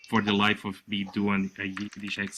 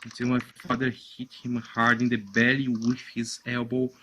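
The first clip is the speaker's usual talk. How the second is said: usual speaking voice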